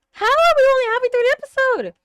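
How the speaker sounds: high, loud and fast incredulous cry; disbelieving outcry. Real, not acted. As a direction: yell it at the screen in disbelief. Disbelief at episode length.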